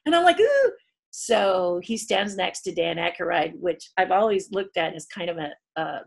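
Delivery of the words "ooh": wince. Retold or imitated. Cringing reaction.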